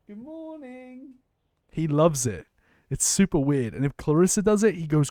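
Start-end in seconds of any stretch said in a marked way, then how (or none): 0.1-1.1 sing-song good morning. Acted. Greeting the dog in the morning.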